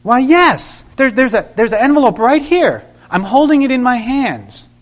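light up, you found it; excited discovery. Retold or imitated. Susie finds the envelope.

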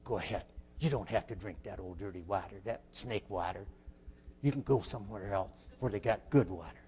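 hushed, low coaxing voice; quiet voice of temptation in the head; tempting inner voice. Retold or imitated. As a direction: speak low and soft, tempting a boy to go. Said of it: "the other voice said".

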